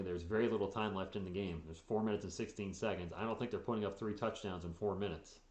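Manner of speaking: usual speaking voice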